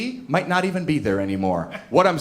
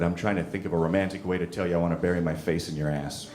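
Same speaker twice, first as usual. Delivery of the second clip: low, soft sheepish reply; very quiet; 8 semitones lower, 6 dB quieter than usual. Retold or imitated. Boyfriend explains himself to his angry partner, very quiet. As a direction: drop low and answer quietly. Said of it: "And I got very quiet".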